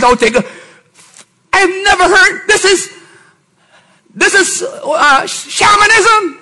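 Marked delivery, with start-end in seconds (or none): 1.5-6.4 much higher, slow outraged cry; outraged preaching. Real, not acted. Pastor denouncing psychology, high outraged cry. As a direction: denounce it as witchcraft.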